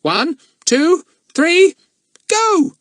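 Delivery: very high, loud squawking parrot. A narrator's reading. The parrot Kiki bossing the librarian, high squawk. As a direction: screech it cheekily like a parrot. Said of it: "said Kiki".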